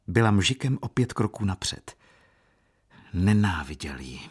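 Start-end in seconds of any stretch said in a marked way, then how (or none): none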